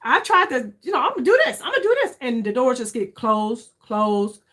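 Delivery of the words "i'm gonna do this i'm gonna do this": higher, lightly hammed-up resolve; a playful imitation of her own earlier eagerness; mock eager self-talk. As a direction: play your past self, pumped and sure. Retelling own eager plans.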